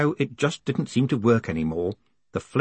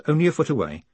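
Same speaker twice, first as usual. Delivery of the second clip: ordinary delivery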